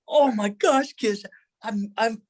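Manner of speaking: high-pitched, overwhelmed acted outcry; retold bawling. To his long-lost brother while hugging him, overwhelmed. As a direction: voice pushed high as if near tears. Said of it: "I started bawling".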